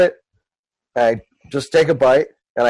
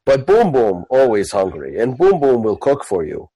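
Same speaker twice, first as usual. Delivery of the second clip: friendly lumbering orc, pitched down; Boom Boom; 7 semitones lower than usual. Acted. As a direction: speak low and plainly like a simple orc. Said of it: "put them back on my belt and go".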